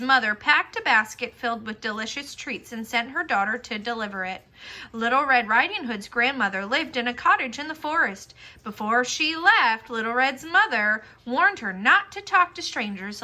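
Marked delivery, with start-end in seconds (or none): none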